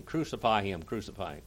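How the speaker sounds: normal talking voice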